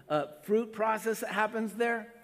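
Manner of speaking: usual speaking voice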